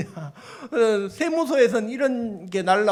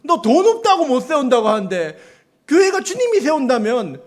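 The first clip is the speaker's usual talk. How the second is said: louder, higher mock scolding from God; preacher voicing God's rebuke, raised and pointed; God rebuking; 6 semitones higher, 5 dB louder than usual. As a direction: scold like God correcting a doubting servant. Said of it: "주님이 그렇게 꾸짖으시는 거예요".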